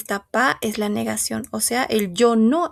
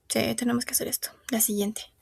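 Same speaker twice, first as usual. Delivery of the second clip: whispered to herself; 7 dB quieter, much faster than usual. Real, not acted. Teacher mutters to herself.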